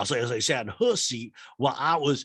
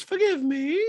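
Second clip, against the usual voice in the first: high falsetto housewife; mock-apology in a put-on high voice; Dorit impression voice; 5 dB louder, slower than usual. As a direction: squeak it out in loud falsetto.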